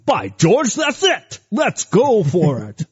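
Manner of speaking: high, hammed-up bad English accent; terrible mock-English statesman's accent, jumping up in pitch; horrible Churchill English accent. Picturing Churchill excited in his bathtub, bad English accent. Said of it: "worst horrible Churchill".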